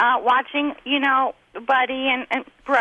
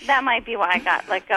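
normal talking voice

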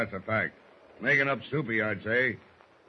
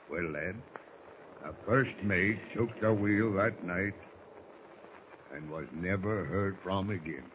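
hushed storytelling; 4 semitones lower, 6 dB quieter than usual. Acted. Spooky legend told to boy.

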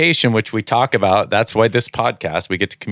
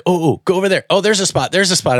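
a higher, eager wife pointing out spots; lightly higher, eager backseat-driver wife; imitating wife; 8 semitones higher, faster than usual. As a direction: play the wife excitedly spotting parking. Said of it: "she's like".

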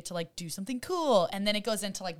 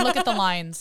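loud, amused "look at the lines"; mock critique; 8 dB louder than usual. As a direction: point out the LINES as a loud pretend expert. Acting out armchair makeup critics.

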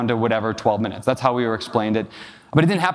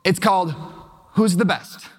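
imitated little daughter; 4 semitones higher than usual. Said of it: "she says, she says".

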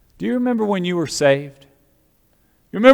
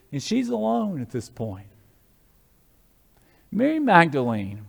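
normal talking voice

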